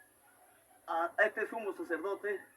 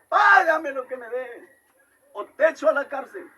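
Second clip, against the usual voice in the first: angry demand; 4 semitones higher, 8 dB louder than usual. Retold or imitated. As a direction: squeeze him for the money. Servant throttling debtor, demanding payment. (exigencia airada, fuerte y lenta)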